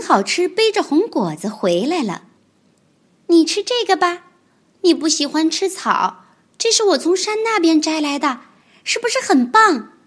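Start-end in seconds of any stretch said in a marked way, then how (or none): 3.3-9.9 高高的童声，天真认真; 小孩一本正经地解释; 天真的小恐龙. Acted. 小恐龙角色对白. (天真的高音童声)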